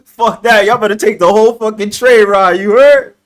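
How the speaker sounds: shouting it out, pitch climbing high; loud joking challenge. Real, not acted. To his friend on the call, a loud playful dare. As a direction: yell the dare at your friend, half laughing.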